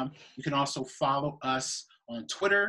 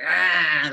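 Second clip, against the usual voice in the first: loud mock growl like Cujo; imitation of a vicious dog's roar; imitated rabid-dog snarl; 2 semitones higher, 7 dB louder than usual. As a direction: burst out an attacking-dog noise. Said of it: "literally Cujo".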